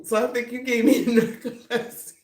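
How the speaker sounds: laughing through the punchline. Real, not acted. Retelling punchline while laughing.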